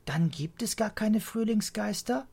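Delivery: put-on high, let-down child's question; mildly crestfallen childlike voice; disappointed child. A narrator's reading. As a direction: ask as if the magic is spoilt. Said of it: "Pia ist enttäuscht".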